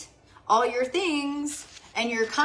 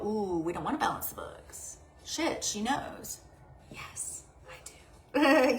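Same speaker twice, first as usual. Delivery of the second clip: hushed aside, much softer than usual; mock hushed dismay; 6 dB quieter, slower than usual. Bank staff realizing the customer knows, mock hushed dismay. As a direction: play them caught out, dropping almost to a whisper.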